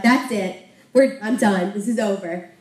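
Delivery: a mild mock outburst of defeat; giving up. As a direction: throw up your hands, she's done. [name] giving up, packing up.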